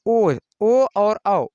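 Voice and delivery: ओ and औ stretched very slow, loud and high; long loud ओ and औ. Shown as a demonstration. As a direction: hold ओ and औ long and let them ring. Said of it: "ओ और औ में". (ओ और औ को ज़ोर से लंबा खींचें)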